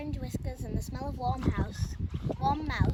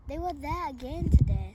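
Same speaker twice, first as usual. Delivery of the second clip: Mister Grinling speaking up, louder; a loud worried report; husband raising his voice about the mice; louder voiced husband; 4 semitones higher, 9 dB louder than usual.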